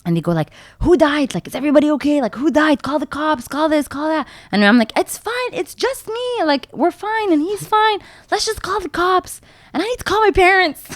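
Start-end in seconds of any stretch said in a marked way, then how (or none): 0.8-4.2 high-pitched panicky bystander; mimicked alarm. Passers-by finding a crash, high retold alarm. 5.0-10.8 very high, retold insisting all is fine; high reassurance. Crash victim calming bystanders, high-pitched reassurance.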